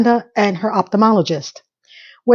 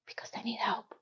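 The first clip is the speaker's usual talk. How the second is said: much softer, whispered tag line; whispered aside; 22 dB quieter than usual. Real, not acted. Vlogger telling viewers men need help, whispered. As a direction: drop to a conspiratorial whisper. Pleading aside for clueless guys.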